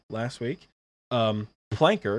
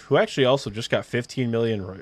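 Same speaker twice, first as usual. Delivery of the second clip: ordinary delivery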